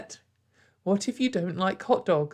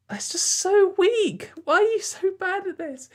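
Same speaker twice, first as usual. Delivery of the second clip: exasperated disbelief; 10 semitones higher, 3 dB louder than usual. Real, not acted. Creator ranting to viewers about a tweet, exasperated scorn. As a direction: pitch up in disbelief at how bad it is. Mocking a weak online joke.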